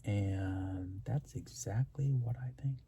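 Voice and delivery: a slow whispered close. Real, not acted. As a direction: whisper it slowly, winding down. Final quiet sign-off.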